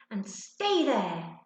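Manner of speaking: slow, loud annoyed order. A narrator's reading. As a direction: still cross with the dog. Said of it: "still annoyed".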